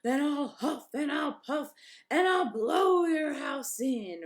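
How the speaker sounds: loud, slow roaring shout; wolf threatening the pig. A narrator's reading. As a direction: roar it at the house. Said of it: "roared the wolf".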